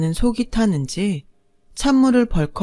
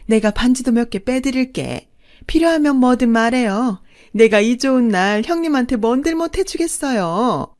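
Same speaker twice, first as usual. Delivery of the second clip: gloating mistress; 5 semitones higher than usual. Acted. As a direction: sweetly rub the victory in her face. Mistress gloats sweetly at wife.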